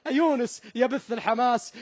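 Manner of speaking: ordinary talk, nothing marked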